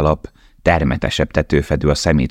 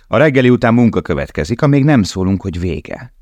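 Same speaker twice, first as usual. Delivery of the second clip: stern guard; 7 semitones higher, 3 dB louder than usual. Acted. Guard addressing prisoners.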